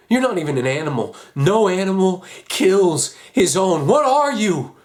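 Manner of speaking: loud, rising outrage; furious condemnation. Acted. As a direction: condemn them, building to a cry. Condemns father in a quarrel.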